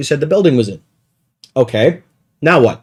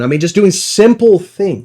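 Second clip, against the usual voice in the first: usual speaking voice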